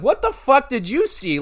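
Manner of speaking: high-pitched baffled demand; incredulous retort. Retold or imitated. Fan retelling his retort to the crowd, incredulous.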